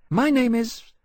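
high, small dragon voice, shyly introducing himself; shy little dragon. A narrator's reading. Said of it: "he added shyly".

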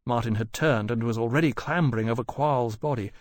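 plain reading voice

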